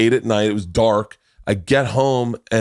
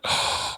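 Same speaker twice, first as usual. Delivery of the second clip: sharp unvoiced gasp of shock; breathy shocked intake; mock gasp; 8 dB quieter than usual. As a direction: gasp as if stunned. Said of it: "And I went".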